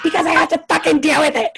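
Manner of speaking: high, loud mocking yell; Rinna. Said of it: "And then Rinna's like".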